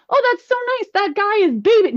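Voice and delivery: sugary high coo; mock gushing. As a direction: coo it like a condescending bystander. Mocking onlookers praising dads.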